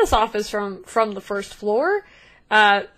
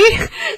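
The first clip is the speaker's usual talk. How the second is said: high burst of laughter; 3 dB louder than usual. Real, not acted. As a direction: laugh at the slip. GM laughing at mistake.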